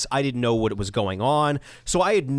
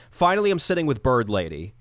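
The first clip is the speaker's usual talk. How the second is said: ordinary delivery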